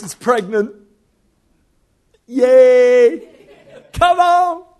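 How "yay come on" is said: loud, high celebratory whoop; excited cheer. Real, not acted. Preacher celebrating eldest daughter's pregnancy, loud cheer. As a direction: cheer it out to a crowd.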